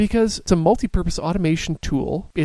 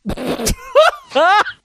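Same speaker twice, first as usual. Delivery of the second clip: cracking up, loud and high; loud high laugh; 5 dB louder than usual. Real, not acted. Host laughs at Johnny's complaint.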